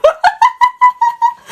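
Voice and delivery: high shrieking laugh. Real, not acted. Amused at the 4D meeting, high shrieking laugh.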